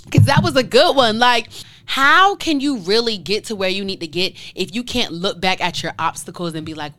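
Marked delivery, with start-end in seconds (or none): none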